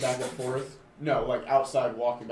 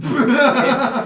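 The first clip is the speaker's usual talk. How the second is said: laughing; 13 semitones higher, 16 dB louder than usual. Real, not acted. Laughing at shirt joke.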